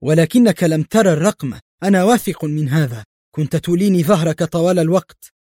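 rattled, bitter objection; agitated, bitter. A narrator's reading. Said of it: "في عصبية تموج بالمرارة".

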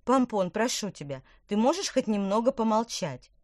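irritated plea. A narrator's reading. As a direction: snap at the chatty carp to hush. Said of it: "раздражённо притопнула ногой".

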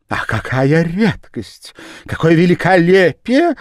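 high, loud gushing lady; gushing Madame Danton. A narrator's reading. Madame Danton gushing to the Japanese man, high and loud. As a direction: gush over the rare pair. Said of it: "говорила она".

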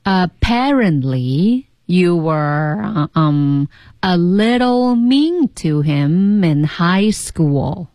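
very slow, careful learner reading; slow repeat-after-me. Shown as a demonstration. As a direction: draw every phrase out, echoing the teacher. Co-host repeats the slow model.

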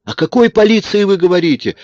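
high, loud indignant cry; angry exclamation. A narrator's reading. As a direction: she snaps back in outrage at the idea of police. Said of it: "сердито воскликнула она". (сердитый возглас)

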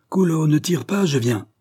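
acted sheriff's shout; calling out. A narrator's reading. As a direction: call ahead while approaching a gunman. Cria-t-il.

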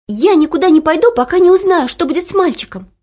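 put-on high, loud, stubborn girl. A narrator's reading. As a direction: refuse to leave, defiant. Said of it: "упрямо тряхнула косичками Оля". (упрямо, высоко и громко)

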